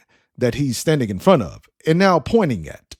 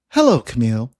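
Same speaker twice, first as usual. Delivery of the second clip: calm feminine device voice; 5 semitones higher, faster than usual. A narrator's reading. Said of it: "with a calm, feminine voice".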